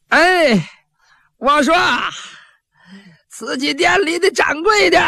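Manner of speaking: high, drawn-out calling shout; yelling at the top of his voice. Acted. Thug calls to the shopkeeper to open up, high shout. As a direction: bellow across the street so the shop hears. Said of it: "你扯开嗓子喊".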